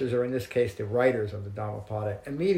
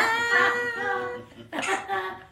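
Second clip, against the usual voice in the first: a real high laugh; high burst of laughter. Real, not acted. Laughs at Brian's joke.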